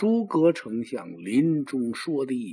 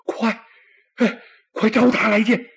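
high, loud urgent order; eager command; 8 semitones higher, 6 dB louder than usual. Acted. Emperor springing up, loudly ordering the messenger brought in. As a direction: jump up and demand him now.

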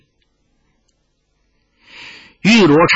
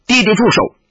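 calling out; 7 semitones higher than usual. A narrator's reading. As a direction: cry out to make him stop. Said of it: "又叫道".

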